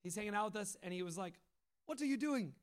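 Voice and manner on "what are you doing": put-on light Papua New Guinean accent, higher; a friendly Papua New Guinean accent asking a question. Saul from New Guinea asks the waiting group, Papua New Guinean accent. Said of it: "He has this awesome accent".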